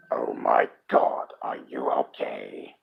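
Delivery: deep voice. Acted. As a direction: play the worried boy, deep and hushed. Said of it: "A fairly deep voice asks".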